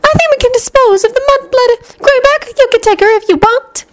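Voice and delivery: very high-pitched villainess, sighing impatience; Bellatrix. A narrator's reading. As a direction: put on a high, put-upon witchy voice. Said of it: "Bellatrix sighed".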